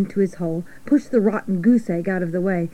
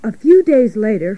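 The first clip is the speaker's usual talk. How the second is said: ordinary delivery, nothing marked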